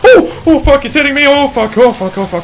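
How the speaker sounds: loud, high panicked yelling, gradually coming down; real panic. Real, not acted. Player fleeing as the monster hits, panicked yelling. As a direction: rattle it out in a frantic yell.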